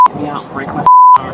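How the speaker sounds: screamed high and very loud; yelling in pain. Real, not acted. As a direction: yell it out in real panic. Driver resisting arrest, yelling.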